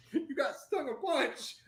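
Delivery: very high, slow, laughing through it; high laughing clerk. Retold or imitated. Clerk telling the customer how badly he was stung. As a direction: giggle it out amazed.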